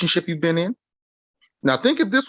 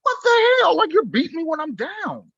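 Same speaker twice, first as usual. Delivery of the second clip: put-on child's voice, pitched way up; high-pitched upset child; high hurt child voice; 4 dB louder than usual. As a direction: play the wounded kid, high and indignant. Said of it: "that child is like".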